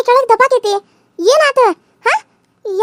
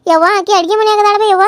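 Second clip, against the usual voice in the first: ordinary talk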